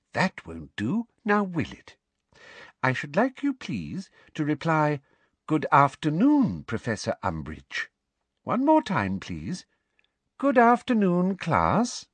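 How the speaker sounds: high, sing-song condescension; prim, sugary teacher. A narrator's reading. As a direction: coach the class as if they were small children.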